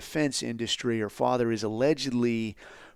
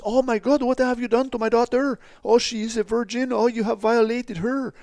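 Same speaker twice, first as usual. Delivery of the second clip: much higher, louder hammed-up wailing; fake outraged parents; 12 semitones higher, 5 dB louder than usual. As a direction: overplay scandalised parents.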